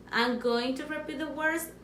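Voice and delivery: ordinary talk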